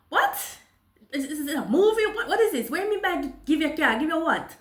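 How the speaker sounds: high, loud disbelief with a laugh; can't believe it's real, is this a movie; retold stunned disbelief, half laughing; incredulous. Disbelief at the carjacking.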